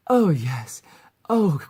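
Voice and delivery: loud, high moaning cries; hard moaning. Acted. Succubus mascot's line five, hard loud moans. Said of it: "also light sexual moans and hard".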